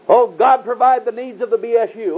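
high-pitched imitated prayer plea; mock praying. Believer asking God vaguely for provision, mock praying.

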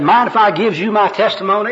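high, eager offer; hammed-up testimony pitch; third gospel worker. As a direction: ask as an overeager evangelist. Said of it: "personal worker number three".

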